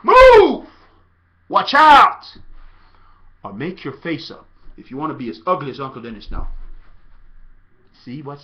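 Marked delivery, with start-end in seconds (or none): 0.0-2.4 very high, very loud yells; shouting. Shown as a demonstration. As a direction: yell them out of your way. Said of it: "Say it with me".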